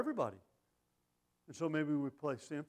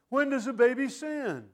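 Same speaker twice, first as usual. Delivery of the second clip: loud and much higher, hammering WHEN; thundering preacher; 9 semitones higher, 8 dB louder than usual. Acted. Preacher repeating the congregant's question, thundering it back. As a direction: throw the question back hard at them.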